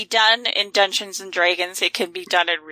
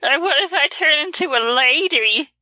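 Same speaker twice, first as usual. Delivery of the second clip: coaxing water horse voice, pitched up; luring water horse; 6 semitones higher than usual. Retold or imitated. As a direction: sweet-talk like a tempting kelpie. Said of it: "That's the Kelpie's voice now".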